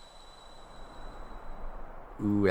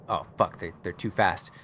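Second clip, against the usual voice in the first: ordinary delivery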